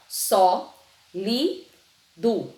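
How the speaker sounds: "Só, li, do" with a clear pause after each syllable. Shown as a demonstration. Teacher helping pupils find the position, each syllable said apart. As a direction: break "sólido" into three separate syllables. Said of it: "vamos identificar".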